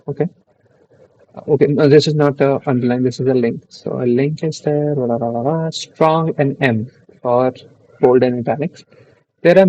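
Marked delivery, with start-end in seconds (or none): none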